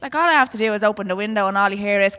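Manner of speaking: usual speaking voice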